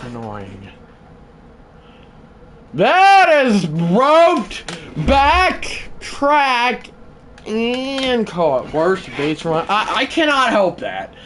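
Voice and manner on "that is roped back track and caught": slow, much higher building call that fades; announcer call. Acted. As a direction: draw it out like a broadcaster tracking the ball. Calls a deep fly ball.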